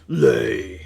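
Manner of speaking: loud one-word command; giant's shout. A narrator's reading. Giant ordering the hen to lay, shouted loud. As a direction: bark it at the hen. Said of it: "the giant shouted".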